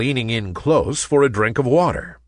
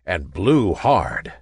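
usual speaking voice

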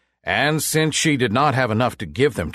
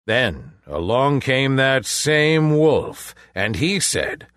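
ordinary delivery

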